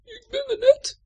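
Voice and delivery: very high, loud squeak; little fish voice. A narrator's reading. Tadpole fish telling Chappie its name, high squeak. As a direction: squeak it proudly like a baby fish. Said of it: "zegt hij".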